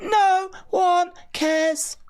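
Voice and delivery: very high, slow sing-song no one cares; mock falsetto. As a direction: drag it out high and mocking. Mocking sing-song dismissal.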